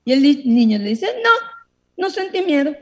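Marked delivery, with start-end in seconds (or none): 1.2-2.7 put-on high, light child's answer; young boy voice. Boy on the plane tells the man he felt no fear, high and carefree. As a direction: pitch it up like a carefree little boy.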